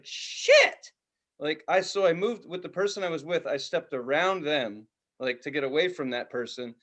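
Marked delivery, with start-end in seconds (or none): 0.0-0.9 high, startled "Shit". Retold or imitated. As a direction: jump at the sudden noise. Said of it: "I was just like".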